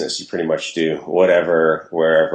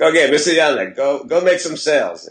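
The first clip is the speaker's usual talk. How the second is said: imitating his dad's Russian accent, pitched higher; a fond higher Russian accent for dad; 10 semitones higher than usual. Imitating his Russian dad sending him out to make sales, in a higher Russian accent. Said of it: "this is his Russian accent".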